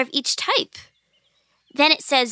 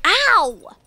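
high yelp of pain; 6 semitones higher, 4 dB louder than usual. Acted. Girl struck on the head by an apple, pained yelp. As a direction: yelp "ow" as if bonked.

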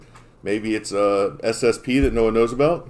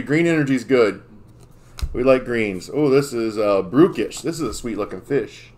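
usual speaking voice

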